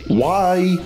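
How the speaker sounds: ordinary delivery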